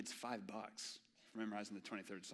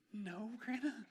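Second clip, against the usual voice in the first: much higher, lightly voiced little boy; a sheepish kid's reply; small boy answering; 11 semitones higher, slower than usual. Child answering grandma.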